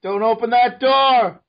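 high, loud, drawn-out warning; mock panicked shout. Warning sitcom family about the door, mock panicked shout. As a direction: holler it like hammy horror.

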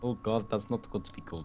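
softly attempted, unconvincing Russian accent; dodgy Russian accent. Player challenged to talk Russian all game, dodgy Russian accent. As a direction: have a poor go at a Russian accent. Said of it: "speak in a Russian accent the entire time".

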